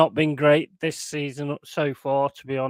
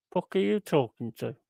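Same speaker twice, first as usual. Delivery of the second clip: normal talking voice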